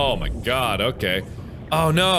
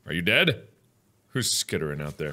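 ordinary talk

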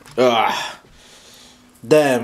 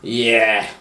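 normal talking voice

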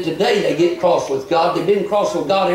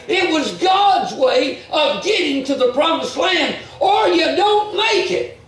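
full-force preaching; 6 semitones higher than usual. Acted. Preacher crying out to the church about God's way, full-force preaching. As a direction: hammer home God's way to the flock.

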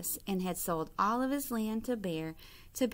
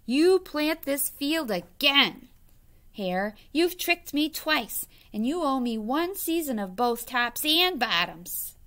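growling Bear; 5 semitones higher, 6 dB louder than usual. A narrator's reading. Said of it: "Bear growled".

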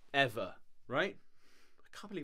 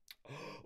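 quiet gasp; 9 dB quieter than usual. Real, not acted. Spots new trailer.

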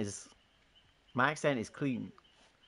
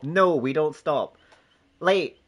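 ordinary talk

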